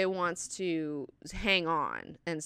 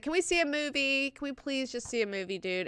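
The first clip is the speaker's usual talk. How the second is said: higher-pitched retold begging; wheedling, imitated request; mock pleading; 8 semitones higher than usual. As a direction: plead like a pal wanting company. Acting out a friend begging.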